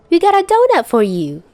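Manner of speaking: a hint of a small girl offering; lightly voiced little girl. A narrator's reading. Morgan speaking.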